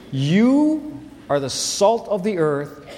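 "You" drawn out and stressed, each word slow; slow emphatic you. Shown as a demonstration. Pastor singling out a seat in the tent, slow stress on "You". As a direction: hand the verse to one listener, slowly. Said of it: "very emphatic about the word "you".

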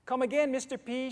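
put-on high, loud child calling out; little girl calling. A narrator's reading. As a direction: call after a grown-up like a small girl. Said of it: "she called".

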